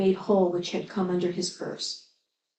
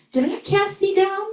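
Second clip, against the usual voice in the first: put-on high, ringing call to the dead boy; loud cry; 11 semitones higher, 3 dB louder than usual. A narrator's reading. Jesus calling to the dead child, high ringing cry. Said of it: "cried with a loud voice".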